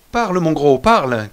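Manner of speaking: loud, commanding call to a dog; loud mocking shout at the man playing a dog. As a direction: bark it out like ordering a pet. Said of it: "criait Derwent".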